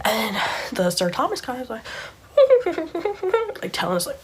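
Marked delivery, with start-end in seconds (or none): 2.3-3.6 high, stuttering put-on sound; high imitated noise. Sir Thomas secretly signalling, high imitated stutter. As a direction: imitate a rapid high titter.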